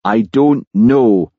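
slow, heavy sulky denial; sullen, drawn-out reply; moody. Acted.

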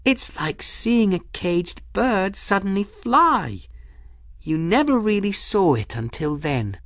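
put-on young woman amazed at the flying ship; awed Folly. A narrator's reading. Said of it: "Folly breathed".

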